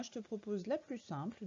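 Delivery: ordinary talk, nothing marked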